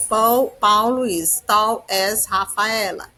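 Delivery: very slow, deliberate English for learners; teacher dictating a model sentence very slowly; very slow example sentence. Shown as a demonstration. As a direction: drag each word so students can follow. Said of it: "Então, Paul".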